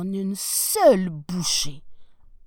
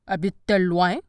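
wolf voice; 2 semitones lower, 8 dB louder, faster than usual. Acted. Wolf questions the girl.

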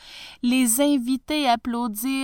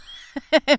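loud, high burst of laughter; hearty prince laugh; 6 semitones higher, 6 dB louder than usual. Acted. Prince laughs at the watchmaker's worry over change, hearty loud laugh. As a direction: laugh out big and free. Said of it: "se mit à rire et à rire".

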